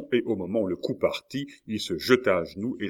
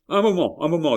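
higher, louder interruption; urgent protest; 9 semitones higher, 7 dB louder, faster than usual. A narrator's reading. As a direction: call out to hold them back. Host halts the departing men. (protestation pressée, voix haute)

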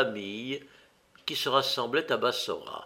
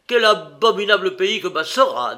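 loud, raised angry exclamation; indignant outburst with a higher pitch; 5 semitones higher, 8 dB louder than usual. A narrator's reading. Egyptian merchant railing at Bassora, angry. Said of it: "paraissait fort en colère".

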